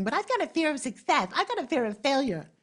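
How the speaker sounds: high, whiny imitation of excuses; mock excuse-maker. Speaker mimicking those who won't act, mock fretful. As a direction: mimic a fretful procrastinator, pitched well up.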